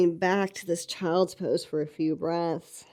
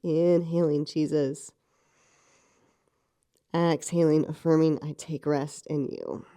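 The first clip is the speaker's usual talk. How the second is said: slow breath prayer; 3 semitones lower, 3 dB louder than usual. Shown as a demonstration. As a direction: breathe the words out slowly. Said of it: "that breath prayer".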